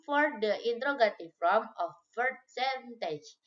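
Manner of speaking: normal talking voice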